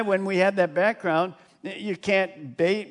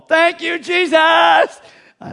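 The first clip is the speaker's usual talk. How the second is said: high, loud shout of thanks; joyful scream; 12 semitones higher, 11 dB louder, much slower than usual. Retold or imitated. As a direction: yell it to the wall next door in joy. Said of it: "He's probably screaming to that other wall".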